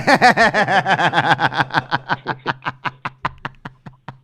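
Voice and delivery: long burst of loud, high laughter. Real, not acted. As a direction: laugh hard and long at the guest.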